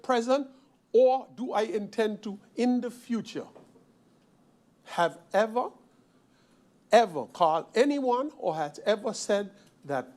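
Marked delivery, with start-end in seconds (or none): none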